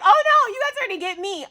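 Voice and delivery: high, loud playful panic; hammed-up high worried squeal; mock alarm. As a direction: act out being caught.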